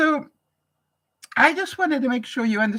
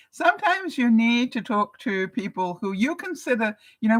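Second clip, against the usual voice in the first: ordinary delivery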